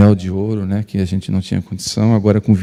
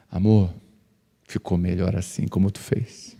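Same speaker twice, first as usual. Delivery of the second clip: grudging admission; 4 semitones lower, 7 dB quieter than usual. Retold or imitated. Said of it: "com muita dificuldade, ela admitiu".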